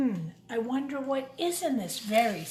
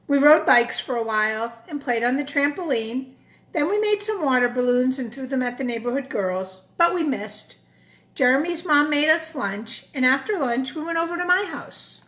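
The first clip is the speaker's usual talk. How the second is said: put-on lively storybook narration for kids; a children's book read aloud; 2 semitones higher, 8 dB louder than usual. A narrator's reading. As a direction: read it like story time in class. Reads picture book to children.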